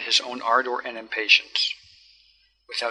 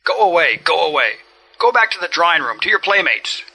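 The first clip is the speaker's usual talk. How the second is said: louder, higher furious shout; enraged shout; 5 semitones higher, 6 dB louder than usual. A narrator's reading. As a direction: bark at the boy to get out. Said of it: "That enraged the man".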